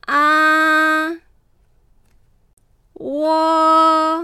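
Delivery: teacher modelling the Chinese vowels one by one; Mandarin vowels. Shown as a demonstration. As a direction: model the six simple vowels slowly and clearly. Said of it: "一度発音してみますね".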